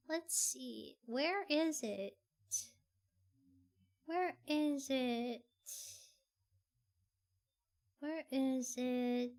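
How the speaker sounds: soft, low, slow talking to oneself; absent-minded searching. Real, not acted. Streamer hunting for a clip, absent-minded murmur. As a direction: draw it out quietly while hunting around.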